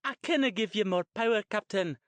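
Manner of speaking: high, loud, hammed-up Scottish yell; terrible Scottish accent. Shara playing the engineer to Jason's captain, terrible Scottish yell. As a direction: yell back as a bad Scottish engineer, quickly. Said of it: "yelled back in a terrible Scottish accent".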